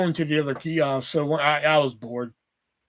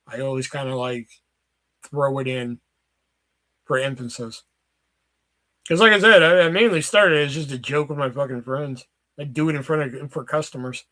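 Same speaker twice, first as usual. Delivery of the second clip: usual speaking voice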